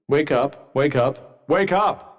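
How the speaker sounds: repeated wake-up call, pitch jumping on the last; insistent rousing. Acted. Companion trying to keep someone from falling asleep, insistent rousing. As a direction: rouse a dozing companion, rising in urgency.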